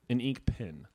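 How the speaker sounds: "pen" with a short i, like pin. Real, not acted. Answering what you write with, "pen" said like pin. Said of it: "A pin or a pen?".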